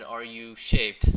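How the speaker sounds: slow, loud, spaced-out words; mimicking word-by-word texting. Coach mimicking her texting back to her, slow and loud word by word. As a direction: punch out each word separately, teasing. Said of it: "mimicking her".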